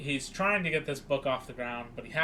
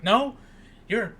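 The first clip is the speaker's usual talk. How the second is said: raised, emphatic mock lecture; retold scolding lecture; 5 semitones higher, 7 dB louder than usual. Grandpa lectures teenage Dwayne in the van, retold insistent scolding. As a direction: play an insistent old man making his case.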